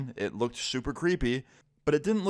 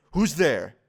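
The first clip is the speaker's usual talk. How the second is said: imitated startled challenge, louder and higher; calling out; 4 semitones higher, 5 dB louder than usual. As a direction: call it out as if from another room. Resident calls out from living room.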